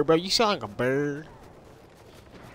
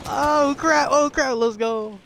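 high, drawn-out whoop; real excited yell; 10 semitones higher, 5 dB louder, slower than usual. Real, not acted. Player cheering in a firefight, excited yell. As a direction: cry out loud and high, stretching it. Excited outburst during game fight.

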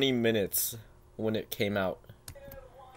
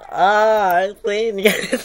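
excited; 8 semitones higher, 8 dB louder, much slower than usual. Real, not acted. Reacting to own voice.

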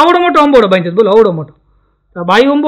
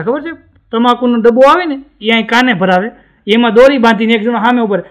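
ordinary delivery

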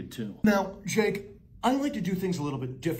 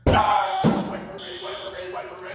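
starts as a high, loud cry, then trails off into breathy pleading; student left behind in the fire drill, panicking; panicked call fading; 5 dB louder than usual. Acted. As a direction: call after the others, then give up.

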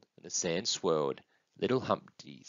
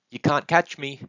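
high, louder taunt; playful calling out; 7 semitones higher, 6 dB louder than usual. Acted. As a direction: call it out like a little one running off. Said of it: "then running off calling".

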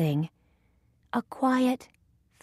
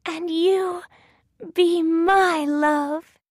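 high, slow little heroine voice; Thumbelina; 9 semitones higher, 4 dB louder than usual. A narrator's reading. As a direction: return the proposal slowly, pitched high. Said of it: "says Thumbelina".

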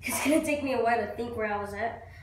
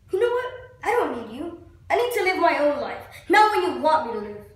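acted defiance; 4 dB louder than usual. Actor tells God he doesn't need him, acted defiance. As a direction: stand up to God and push him away.

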